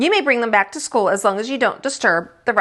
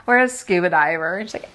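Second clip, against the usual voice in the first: ordinary delivery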